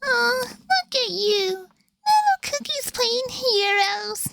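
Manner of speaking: high, sly jester villain voice; Shadow Milk voice. Acted. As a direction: play the sly high-pitched trickster. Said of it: "Shadow Milk voice".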